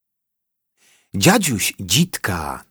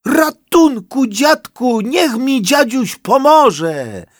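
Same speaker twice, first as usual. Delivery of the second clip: very high, loud, slow snivelling plea; whimpering plea; 6 dB louder than usual. A narrator's reading. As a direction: sob out the cry for help. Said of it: "zachlipał dziwoląg".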